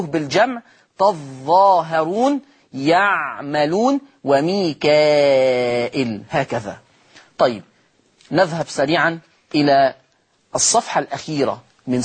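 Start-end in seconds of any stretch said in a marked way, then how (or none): 4.2-6.2 وميكائيل very slow, long a then hamza "Mika-il"; Warsh with a joined long vowel. Shown as a demonstration. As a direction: lengthen the a in وميكائيل fully before the hamza. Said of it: "فتصير مد متصل".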